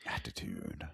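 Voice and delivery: breathy dramatic whisper; hushed echo of the topic. Real, not acted. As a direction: whisper the word for effect. Echoing the topic word dramatically.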